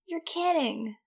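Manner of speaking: high voice through a mouthful; mouth full. A narrator's reading. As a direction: talk round a bite of food. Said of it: "with his mouth full".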